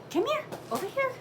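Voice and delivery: sing-song dog call. Real, not acted. Owner coaxing her big dog over, in a high sing-song. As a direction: lilt it up high to lure the dog.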